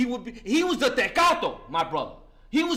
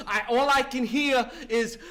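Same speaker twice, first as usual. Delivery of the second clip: ordinary talk, nothing marked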